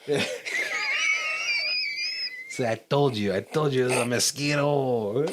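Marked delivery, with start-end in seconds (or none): 0.3-2.4 sudden high shout of laughter. Real, not acted. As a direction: burst out laughing. Bursts out laughing at mosquito joke.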